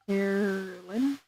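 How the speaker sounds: "Carolyn" drawn out slowly and quietly; slow soft repeat. Acted. Innkeeper slowly savours the guest's name, "Carolyn", softly.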